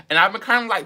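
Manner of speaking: normal talking voice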